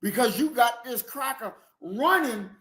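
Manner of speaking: ordinary talk, nothing marked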